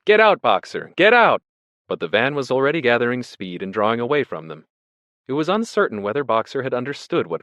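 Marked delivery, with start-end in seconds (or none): 0.0-1.4 raised, loud urging; crowd's cry. A narrator's reading. Animals taking up Clover's warning, crying out. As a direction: call it out, rising on the repeat. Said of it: "took up the cry of".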